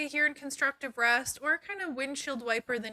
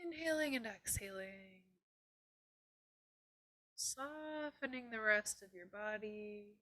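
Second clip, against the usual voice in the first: guided meditation; 9 dB quieter than usual. Acted. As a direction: softly guide them into calm.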